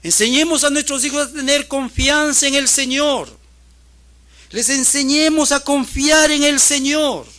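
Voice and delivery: loud, high preacher's shout; full-force preaching. Real, not acted. Preacher urging parents to teach their children trust, full-force preaching. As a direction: exhort them at the top of your voice.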